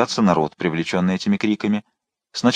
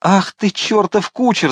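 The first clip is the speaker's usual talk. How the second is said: slow, much higher cursing; pained angry shout; 10 semitones higher, slower than usual. A narrator's reading. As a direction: curse the coachman through the pain. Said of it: "закричал он". (злобный крик от боли)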